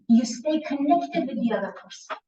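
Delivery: normal talking voice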